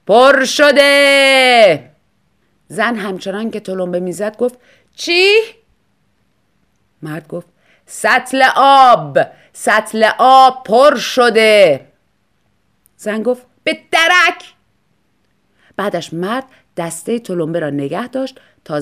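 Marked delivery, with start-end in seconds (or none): 0.1-1.9 slow, drawn-out high yell; husband shouts. Acted. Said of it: "مرد داد زد". 5.0-5.4 high, loud shout over noise; shouted what. Acted. Wife can't hear over pump. 7.9-11.9 slow, loud, drawn-out yell; husband yelling. Acted. Husband repeats over the pump. 13.6-14.5 high, loud angry retort; angry snap. Acted. Wife dismisses it angrily.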